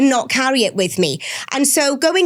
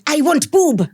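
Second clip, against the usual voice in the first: put-on higher, playful Swedish-tinged toddler accent; 5 semitones higher, slower than usual. Mother showing how her toddler sounded, higher Swedish-like toddler accent. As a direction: mimic a tiny child who sounds a bit Swedish. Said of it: "She almost sounded quite Swedish".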